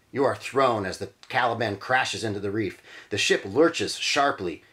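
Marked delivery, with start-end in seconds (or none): none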